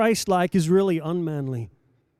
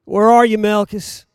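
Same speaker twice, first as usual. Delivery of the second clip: calling out; 3 semitones higher, 8 dB louder than usual. Acted. Preacher calling out again.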